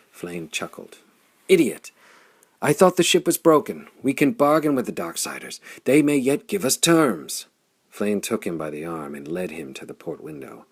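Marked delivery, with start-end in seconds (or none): 1.5-7.5 loud, high, contemptuous outburst; angry scorn. Acted. Hearth scorns Flame's plan to flee, angry shout. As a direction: spit the insult, furious.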